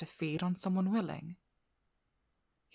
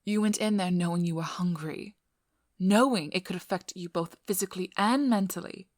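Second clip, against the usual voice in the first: loud, emphatic reprimand; angry scolding; 7 dB louder than usual. Acted. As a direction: scold them loudly, hitting the key words. Angrily scolding vampire for risky venture.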